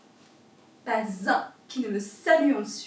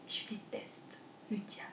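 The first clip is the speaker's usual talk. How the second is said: hushed whisper; 3 semitones lower, 15 dB quieter than usual. Acted. A dishwasher whispering her hatred of the devil. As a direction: whisper it, much softer. Dishwasher's litany of hates and loves.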